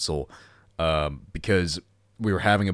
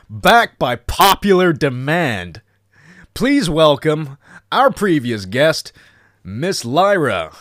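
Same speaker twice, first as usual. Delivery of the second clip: slow, loud guest introduction; announcer voice; 8 semitones higher, 7 dB louder, slower than usual. Acted. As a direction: build it up like a showman.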